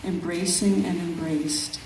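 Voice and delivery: measured, unhurried poetry reading; calm, reflective recital of a nature poem. Acted. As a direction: let each image breathe in its pauses. Reads her poem aloud.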